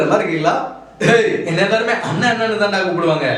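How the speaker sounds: ordinary talk, nothing marked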